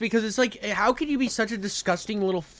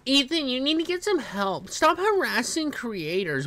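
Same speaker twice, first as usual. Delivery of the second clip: higher, lightly put-on preachy voice; a hint of a smug lecturer; lightly mocking Hasan's talking points; mock Hasan line; 6 semitones higher, 3 dB quieter than usual. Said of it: "Hasan talking points".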